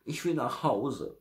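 high-pitched wail to go home; little bear calling. A narrator's reading. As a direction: call out like a lost bear cub. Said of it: "rief der kleine Bär". (hohes Rufen eines kleinen Bären)

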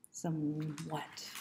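ordinary delivery, nothing marked

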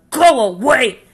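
loud, high snarl of a grumpy bug; grouchy shout. Acted. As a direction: shove the words out at him. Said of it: "shouted the grouchy ladybug".

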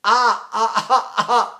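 short open ah cries; baby "ah" position. Shown as a demonstration. Teacher shows his vowel position, short open cries. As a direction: bounce out open ahs like a baby. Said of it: "I say everything in this".

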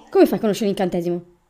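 ordinary talk

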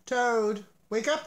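loud high wake-up call; cried out. A narrator's reading. Frog calling to sleepy Toad, loud and high. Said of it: "he cried".

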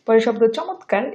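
usual speaking voice

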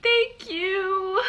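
high, dazed little thank you; stunned thanks. Retold or imitated. Thanking the friend after the spider, dazed and high. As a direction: thank her, still in shock. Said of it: "I was like".

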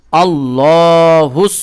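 আল্লাহুস held very long and chanted; drawn out. Shown as a demonstration. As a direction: stretch আল্লাহুস with a full voice. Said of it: "হুস পড়বো".

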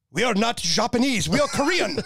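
put-on a colonel's Korean accent, hinted; imitating Korean accent. Retelling the Korean colonel's correction, mock Korean accent. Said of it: "he goes, he goes".

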